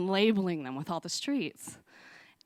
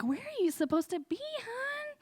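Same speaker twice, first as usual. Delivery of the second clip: put-on gentle old woman; 4 dB quieter than usual. Old woman asks lost child.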